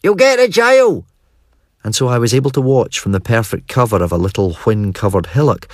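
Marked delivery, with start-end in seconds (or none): 0.0-1.1 high, louder lad's yell; schoolboy shouting; boyish warning shout. A narrator's reading. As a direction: warn your pal, high and urgent.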